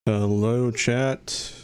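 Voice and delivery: ordinary talk, nothing marked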